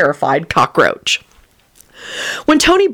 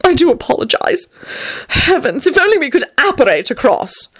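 rapturous; 8 semitones higher than usual. Acted. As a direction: gush it out in raptures. Vampire Tony's voice.

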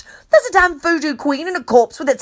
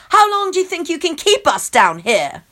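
demanding; 6 semitones higher than usual. A narrator's reading. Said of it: "I demand".

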